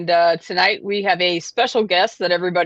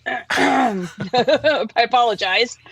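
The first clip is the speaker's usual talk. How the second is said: after choking on coffee; coughing, throat clearing; 2 semitones higher, much slower than usual. Real, not acted. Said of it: "I'm choking on my coffee".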